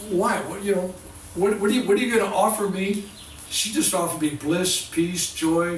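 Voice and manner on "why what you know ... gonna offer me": higher, louder, exasperated; indignant demand. Retold or imitated. Healer retells demanding what the guru offers, loudly indignant. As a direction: push back at the guru, incredulous. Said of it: "And I go".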